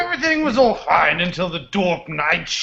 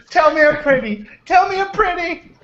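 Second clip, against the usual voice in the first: mock needy plea; 11 semitones higher than usual. Joking club line, pitch up.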